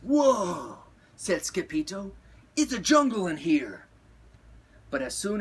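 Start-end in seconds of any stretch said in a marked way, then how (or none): 0.0-0.8 loud high whoa; amazed. A narrator's reading. Skipito stepping into his jungle closet, loudly amazed. 2.5-3.9 loud, raised-pitch wonder; amazed. A narrator's reading. Skipito seeing the jungle in his closet, loudly amazed.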